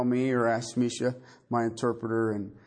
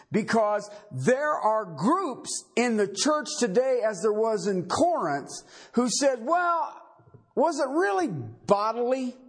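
higher, urgent preaching, building; full-force preaching; 11 semitones higher than usual. Real, not acted. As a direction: drive the point home from the pulpit.

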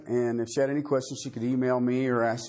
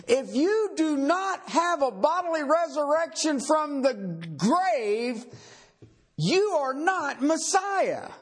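higher, urgent preaching, building; full-force preaching; 13 semitones higher than usual. Real, not acted. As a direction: drive the point home from the pulpit.

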